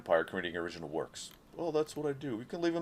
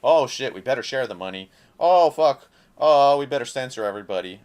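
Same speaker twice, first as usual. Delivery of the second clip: loud, hammed-up panic of a company boss; mock panicking company; 3 semitones higher, 10 dB louder than usual. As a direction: blurt it out as the greedy company caught out, much louder. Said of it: "mock company panicking".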